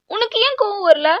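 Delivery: high, loud challenge; accusing outburst. Acted. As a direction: demand an answer, voice shooting up. Accusing partner in quarrel. (குற்றம் சாட்டும் கோபம்)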